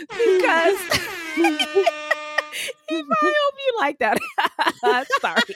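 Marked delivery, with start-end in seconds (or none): 0.1-5.2 squeaky, high words through giggles; helpless laughter. Real, not acted. Host after singing to a patron, breathless laughing. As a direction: try to talk while cracking up. Breaks up after own song.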